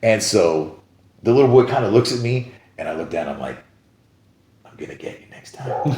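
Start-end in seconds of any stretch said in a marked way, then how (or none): 4.7-6.0 hushed whisper, playfully menacing; secretive threat. Retold or imitated. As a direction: whisper a playful warning to a kid. Batman secretly addressing the little boy.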